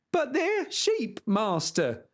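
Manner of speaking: cried out. Acted. Squire calling after the charging knight, high cry. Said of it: "cried Sancho".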